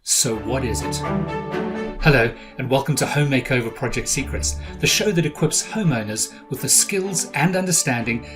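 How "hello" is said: usual speaking voice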